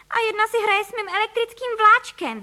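put-on very high, loud child figure; outraged cry. A narrator's reading. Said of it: "vykřikl Filip".

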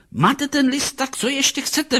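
very high, exasperated woman's outburst; snapping at him. A narrator's reading. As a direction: round on him, pitch way up. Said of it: "vyjela na něho". (podrážděně na něj vyjede)

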